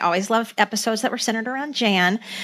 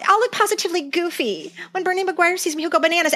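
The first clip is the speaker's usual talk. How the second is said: high, hammed-up teen girl voice; quoting young Jan, mock dismay; mock girly Jan; 8 semitones higher, faster than usual. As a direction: replay the favorite line with relish. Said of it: "I know".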